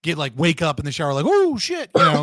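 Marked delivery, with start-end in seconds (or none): 1.3-1.9 sudden high startled cry; shocked yelp. Retold or imitated. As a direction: yelp at the cold water. Cold water shock, very high.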